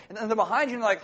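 usual speaking voice

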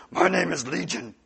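put-on demon voice, voiced; voiced, theatrical demon introduction; demon naming itself. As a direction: announce it as the demon, at normal volume. Demon's name, first full take.

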